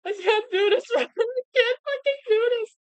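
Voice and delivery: high-pitched words breaking into laughter; can barely get the words out through real laughter; squeaky, laughing disbelief; laughing helplessly. Real, not acted. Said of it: "I'm laughing so hard".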